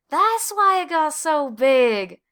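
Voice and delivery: slow, loud, high exclamation; Desmond exclaiming. A narrator's reading. Desmond echoing Clayton about the pumpkin, loud and slow. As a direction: burst out with the discovery.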